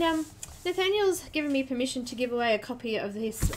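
usual speaking voice

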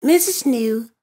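usual speaking voice

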